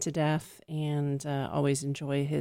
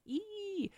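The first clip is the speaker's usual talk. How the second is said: excited squeal; 9 dB quieter than usual. Real, not acted. Excited squeal about the years.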